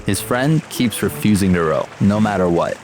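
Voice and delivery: ordinary delivery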